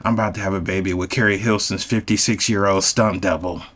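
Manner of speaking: usual speaking voice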